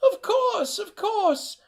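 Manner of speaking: high quavering grandmother voice; a doting old nana greeting her boy; delighted old woman greeting her grandson, high-pitched. Acted. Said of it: "Emma Joe retrieved the funds".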